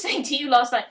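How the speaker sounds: ordinary talk, nothing marked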